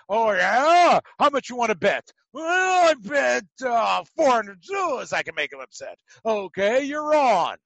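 loud, high back-and-forth dare between two men; hammed-up bet. Friend daring friend over the bet, shouted loud and high. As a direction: bark the wager like a cocky gambler.

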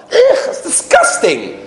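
very high, drawn-out revulsion; disgusted. Acted. Onlooker seeing a child lick dirty candy, disgusted. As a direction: recoil with an exaggerated ugh.

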